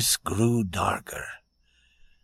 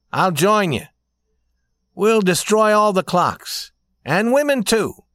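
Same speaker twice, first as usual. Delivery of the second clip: higher, louder declaration of revolt; young man, defiant; 9 semitones higher, 5 dB louder than usual. A narrator's reading. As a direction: throw yourself into the plan, voice climbing.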